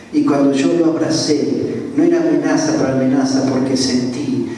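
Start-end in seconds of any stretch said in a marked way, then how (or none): none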